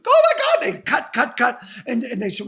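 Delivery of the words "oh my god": high, loud startled cry; mock scared shriek. Imitating Salma Hayek on set, a hammed-up scared shriek. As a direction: shriek it like a frightened actress. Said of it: "she went".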